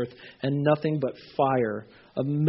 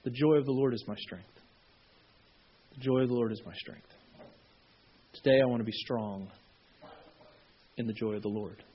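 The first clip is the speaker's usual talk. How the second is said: soft, hushed prayer, said inwardly; quiet prayer; 5 semitones lower, 7 dB quieter than usual. Shown as a demonstration. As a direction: pray it softly to yourself. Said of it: "this was your prayer".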